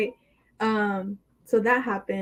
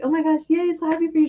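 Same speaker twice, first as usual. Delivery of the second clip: higher, gushing retold delight; excited congratulations; 7 semitones higher, faster than usual. Best friend congratulating her on the sorority, excited. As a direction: gush it in a raised pitch.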